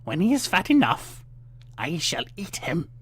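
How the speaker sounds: put-on bully the girl awake; loud harsh witch scolding. A narrator's reading. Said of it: "the witch said".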